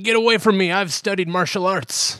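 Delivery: high-pitched mock outrage; alarmed old lady. Old lady fending off the bum, high indignant cry. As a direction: warn off an attacker in a high voice.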